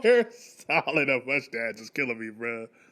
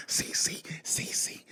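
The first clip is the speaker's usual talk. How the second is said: whispered name-calling; hushed imitation of someone searching; mock furtive calling; 12 dB quieter than usual.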